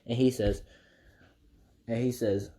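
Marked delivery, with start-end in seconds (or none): none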